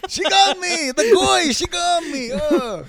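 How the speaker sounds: put-on high, loud Yiddish-accented kvetch.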